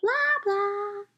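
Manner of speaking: high, sing-song chatter; a teasing high "blah, blah"; mocking blah blah. A narrator's reading. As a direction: mimic an adult who won't stop talking. Mom talking through story time.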